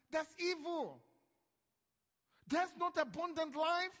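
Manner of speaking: rising sermon fervour; fired-up preaching. Acted. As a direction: preach it hard to the congregation. Preaching at full force.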